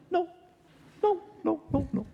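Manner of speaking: high, dismissive repeated no; mock refusal. Drivers passing the hitchhiker, high dismissive refusals. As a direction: brush off the hitchhiker. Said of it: "they're saying".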